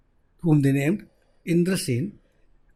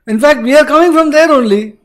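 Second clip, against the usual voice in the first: louder, higher emphatic telling; emphatic Indra; 7 semitones higher, 9 dB louder than usual. Acted. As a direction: insist it's already done. Indra tells Kali, loud.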